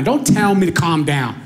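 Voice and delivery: ordinary talk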